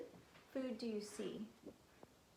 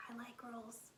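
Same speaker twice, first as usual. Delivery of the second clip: soft whispered aside; 9 dB quieter than usual. Real, not acted. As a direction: whisper it like a little secret. Confiding after the song.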